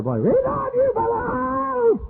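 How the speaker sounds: put-on very high, drawn-out children's call; kids screaming. Nursery kids calling through the door, very high and stretched. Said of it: "they'll scream out there".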